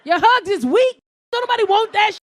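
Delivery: very high, loud angry shouting; furious rejection. Acted. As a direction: scream him down in outrage. Acted explosion at his offer.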